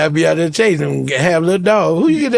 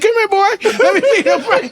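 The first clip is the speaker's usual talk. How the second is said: mock sweet-talk to a dog; 13 semitones higher, faster than usual. Checking pricey dog in store.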